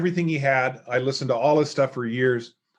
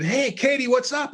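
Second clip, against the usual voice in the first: bright, high-pitched call across the room; cheery hello; 10 semitones higher than usual. Retold or imitated. Teen boy calling out a high, eager hi to Katie. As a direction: chirp a greeting at the smart girl.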